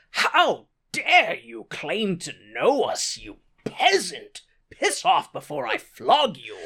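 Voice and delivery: slow, indignant aristocrat putting down a peasant; hammed-up snooty outrage; haughty aristocrat. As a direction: draw it out with lordly disdain. Snooty cousin scolds peasant, acted.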